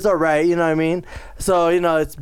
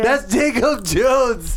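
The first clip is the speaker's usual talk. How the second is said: mock announcement; 5 semitones higher, much slower than usual. Slow drawn-out mock naming.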